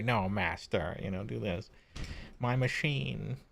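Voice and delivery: lightly voiced scheming servant murmuring to his master; worm tongue henchman. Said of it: "the worm tongue henchman guy who's always whispering in the ear".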